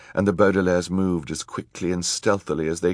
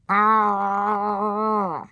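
long, high-pitched "ah"; drawn-out cry, pitched way up; startled cry. A narrator's reading. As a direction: let out a sustained high wail. Judge reacts to the threats.

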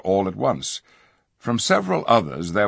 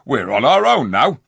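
defiant dwarfs; 6 semitones higher, 6 dB louder than usual. A narrator's reading.

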